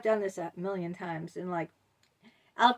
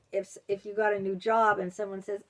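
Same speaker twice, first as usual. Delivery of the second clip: ordinary delivery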